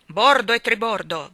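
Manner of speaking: loud admiral's bellow; shouted. A narrator's reading. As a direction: bark it like on deck. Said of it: "gridò l'ammiraglio".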